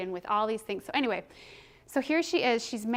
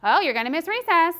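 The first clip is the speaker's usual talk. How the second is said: higher, louder mock threat; teacher threatening a child with recess, mock warning; 7 semitones higher, 9 dB louder than usual. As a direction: dangle it over the child like a warning. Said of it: "held over their head".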